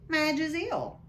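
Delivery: high, loud blustering uncle; Vernon's gruff voice. Acted. Uncle reading the postcard aloud, loud and blustering. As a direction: bark it like a pompous uncle.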